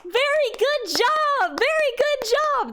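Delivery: high, slow, overly sweet encouragement; gushing praise. Retold or imitated. Spanish speaker praising a learner's bad Spanish, high sugary cheer. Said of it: "will be like".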